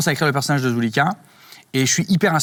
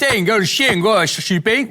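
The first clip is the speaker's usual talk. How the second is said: slow, high mock southern French accent; a deliberately bad southern French accent on request; 9 semitones higher, 3 dB louder, much slower than usual. Shown as a demonstration. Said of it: "Allez, l'accent du Sud".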